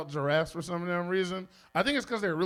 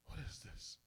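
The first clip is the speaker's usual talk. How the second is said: a frightened unvoiced whisper at 3am; hushed whisper; 20 dB quieter than usual. Recalling a creepy video late at night, whispered. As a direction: whisper it like you just saw something creepy. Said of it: "I was just like".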